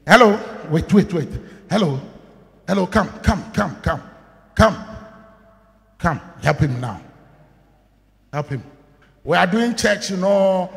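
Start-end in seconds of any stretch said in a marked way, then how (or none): none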